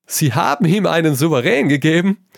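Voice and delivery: loud, high, laughing disbelief; roaring with laughter. A narrator's reading. Trevor howling at Hugo's blunder, laughing loudly. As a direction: bellow it while breaking up laughing. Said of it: "brüllte Trevor und konnte sich vor Lachen nicht halten".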